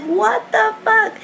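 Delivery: high, startled what the fuck; jolted alarm at doctors rushing in; shocked outburst. Retold or imitated. New mom reacting to doctors rushing in, shocked.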